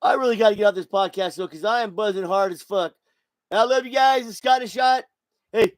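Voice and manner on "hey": signoff shout. Real, not acted. Podcast sign-off shout.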